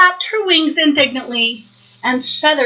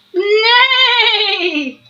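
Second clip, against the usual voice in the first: high whinnying neigh; bellowed neigh; 9 semitones higher than usual. Acted. Duck surprises the horse, neighing high. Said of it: "bellowed out a".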